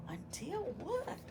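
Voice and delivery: high breathy whispered question. Acted. Storyteller whispering a teaser to the children. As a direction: whisper the question to build suspense.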